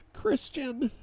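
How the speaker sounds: high, desperate cry of the name. A narrator's reading. Wife calls husband's name, desperate. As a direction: call out pleading, pitched far up. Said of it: "Her desperation is laced with every syllable".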